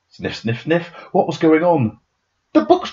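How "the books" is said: Peter cries out. A narrator's reading. Child Peter discovers the missing books, crying out. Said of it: "cried out Peter".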